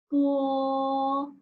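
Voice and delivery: "Po." with a burst of air, first tone; aspirated po. Shown as a demonstration. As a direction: blow air out on "Po.". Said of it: "Make sure the strong air".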